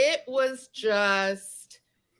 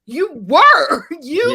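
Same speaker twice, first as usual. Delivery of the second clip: loud, raised retort; indignant insistence; 4 semitones higher, 11 dB louder, slower than usual. Retold or imitated. Insisting to a friend she was targeted, loud retort. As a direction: punch it back accusingly, re-enacting the row.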